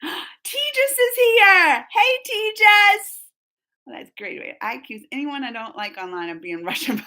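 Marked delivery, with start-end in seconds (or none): 0.0-3.2 high, loud, drawn-out hello; excited greeting. Real, not acted. Streamer spots a favorite viewer arriving, excited greeting. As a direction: sing out the name, stretched and thrilled.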